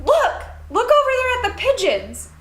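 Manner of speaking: mock mom drawing attention; mom pointing out. Mom pointing out the pigeons to her daughter. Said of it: "She's like".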